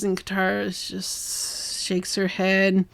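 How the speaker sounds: ordinary talk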